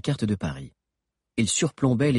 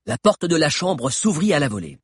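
usual speaking voice

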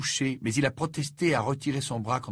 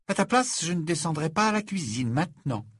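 put-on high girl's voice giving a friendly warning; warning Ginny; 7 semitones higher than usual. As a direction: voice Ginny, tipping him off.

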